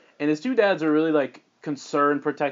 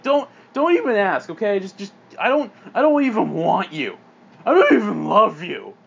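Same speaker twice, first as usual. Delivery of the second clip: raised, heated, halting mom voice; cruel rejection; 5 semitones higher, 5 dB louder than usual. Acted. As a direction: push the daughter away angrily.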